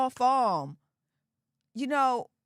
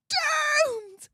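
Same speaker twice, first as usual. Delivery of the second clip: hammed-up doomed cry, much higher; mock wail. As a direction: wail it like a tragic heroine, tongue in cheek. Mock despair over her accent.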